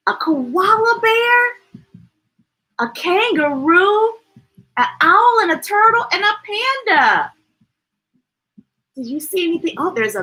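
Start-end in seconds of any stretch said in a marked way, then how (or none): 0.1-7.3 excited. Acted. Pointing out animals to child.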